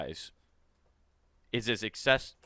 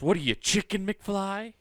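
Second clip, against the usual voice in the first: higher-pitched mock taunt; taunting bully quote; 8 semitones higher than usual. Co-host quoting the movie's chicken line, mock taunt. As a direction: needle them like a film bully. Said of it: "No, he calls".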